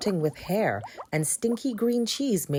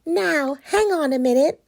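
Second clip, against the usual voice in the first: high, bright pumpkin; bubbly eager pumpkin; cheerful pumpkin voice; 10 semitones higher, 7 dB louder than usual. Acted. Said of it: "said Christopher P".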